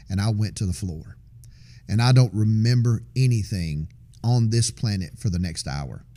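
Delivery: normal talking voice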